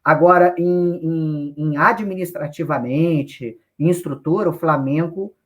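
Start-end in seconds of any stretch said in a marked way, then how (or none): none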